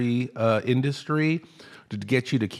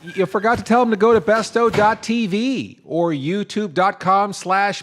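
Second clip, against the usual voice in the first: loud high boss voice; 11 semitones higher, 5 dB louder than usual. Acted. Skit boss calls after employee.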